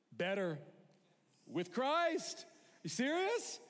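very high, loud incredulous voice; hammed-up disbelief at his good luck; Paul thrilled at the threat of death; delighted Paul. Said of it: "He's like".

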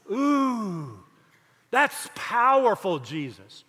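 awed disciples. Retold or imitated. Imagined disciples reacting to Jesus's teaching, mock awe. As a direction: act out being deeply impressed, slowly.

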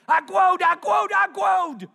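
put-on high, loud toddler shout calling for daddy; little girl yelling. Daughter bolting down the jetway after her father, high excited toddler yell. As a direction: yell it like a three-year-old racing to find her dad. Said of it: "here's what she was saying".

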